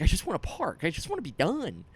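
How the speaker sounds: high, desperate mock pleading; panicky. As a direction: whine it like you're stuck circling for parking. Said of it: "panicky feeling where you're just like".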